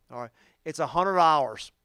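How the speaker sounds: usual speaking voice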